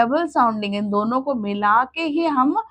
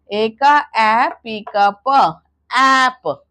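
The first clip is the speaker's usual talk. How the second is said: slow phonics drill; 4 dB louder, much slower than usual. Shown as a demonstration. Teacher drilling mothers on sounds, slow and loud. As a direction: stretch out ए का ए slowly and loudly.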